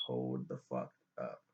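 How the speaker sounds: low, slow, menacing Catelyn; Catelyn stepping in. Retold or imitated. Retelling Catelyn halting the wedding, low and slow. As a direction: drop the voice low and drag it out. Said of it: "And Catelyn is like".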